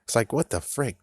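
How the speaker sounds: ordinary talk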